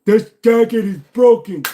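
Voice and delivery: high, loud hammed-up shout; mock outburst. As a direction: yell it in mock outrage. Mock outburst over broken jacket.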